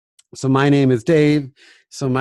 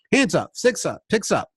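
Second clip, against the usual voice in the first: cueing call; 6 semitones higher than usual. Retold or imitated. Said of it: "his way of cueing them".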